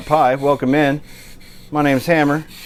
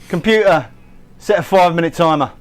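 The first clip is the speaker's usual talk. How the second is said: higher-pitched put-on London accent; American faking a London accent; 5 semitones higher than usual. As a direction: give the command in a mock London accent. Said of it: "this is probably a shite accent".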